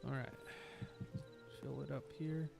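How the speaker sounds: usual speaking voice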